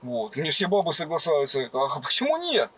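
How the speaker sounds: usual speaking voice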